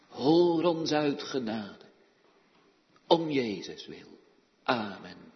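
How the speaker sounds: solemn praying voice, rising in petition; pastoral prayer. Acted. Minister praying for caregivers, solemn earnest prayer. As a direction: pray aloud for the congregation, earnest.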